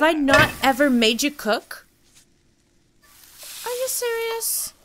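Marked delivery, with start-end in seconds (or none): 3.6-4.7 very high-pitched, exasperated urging; high, flustered nagging; frantic scolding. Real, not acted. Hurrying a Sim late for school.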